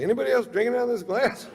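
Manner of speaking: high, fast, bewildered squeak; puzzled drunk; baffled drunk. Retold or imitated. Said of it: "I'm like".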